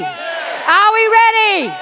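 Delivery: high, slow, stretched-out crowd shout; rallying shout. Real, not acted. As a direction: whip the crowd up with drawn-out words. Rousing the crowd for a louder answer.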